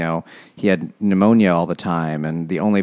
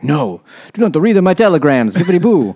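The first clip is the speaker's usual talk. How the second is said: hammed-up Italian protest, higher and louder; hammed-up Italian accent, high and loud; mock Italian; 8 semitones higher, 5 dB louder than usual. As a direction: play a cartoon Italian guy. The fiery Italian Richetti protesting.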